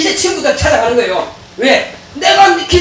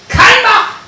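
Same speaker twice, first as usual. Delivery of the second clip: sharp rebuke; 9 semitones higher, 3 dB louder, much slower than usual. Acted. Preacher rebuking the devil.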